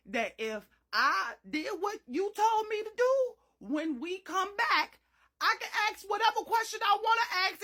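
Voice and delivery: high, loud no ma'am protests; defiant protest. Retold or imitated. Daughter arguing mama owes answers, loud indignant. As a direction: push back hard on mama. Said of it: "I said".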